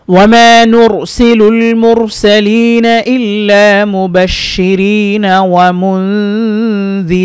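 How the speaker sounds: slow, melodic tajweed chant with long vowels; Quran recitation. Acted. Teacher recites the whole verse for students in a slow chant. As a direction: draw each vowel out in a measured recitation.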